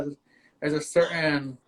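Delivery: ordinary delivery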